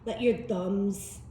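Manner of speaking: soft, unhurried meditation guide voice; guided meditation. Acted.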